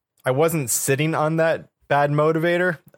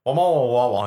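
droid babble. Acted. Droid noises.

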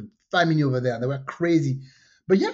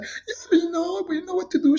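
woman replied; 12 semitones higher than usual. Retold or imitated. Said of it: "and she's like".

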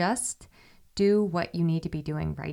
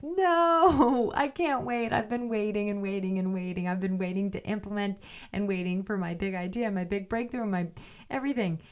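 impatient protest; 2 semitones higher than usual. Retold or imitated. To her friend, refusing to wait: mock impatient whine. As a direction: act out the impatient protest, half laughing.

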